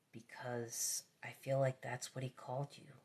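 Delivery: soft unvoiced whisper. Real, not acted. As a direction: share a hunch under your breath. Intuitive aside.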